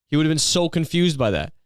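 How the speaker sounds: ordinary delivery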